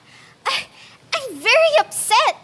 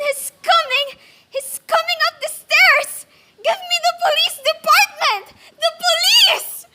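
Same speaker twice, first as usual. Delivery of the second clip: rising to a high, breaking scream of terror; terrified panic; 9 semitones higher, 3 dB louder than usual. Acted. Alone in bed, begging the operator as the killer climbs, screaming in terror. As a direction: panic as the footsteps come up.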